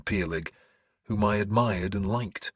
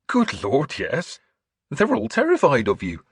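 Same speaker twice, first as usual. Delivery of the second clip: high, loud, lively churchman; animated high-pitched priestly exclamation; exclaiming bishop; 8 semitones higher, 6 dB louder than usual. Acted. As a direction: burst out with it, pitch leaping up.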